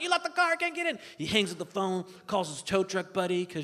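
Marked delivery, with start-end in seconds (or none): 0.0-1.0 high-pitched, insistent dad; frantic dad. Acted. Dad accuses daughter over the phone, high frantic insistence. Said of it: "He's like".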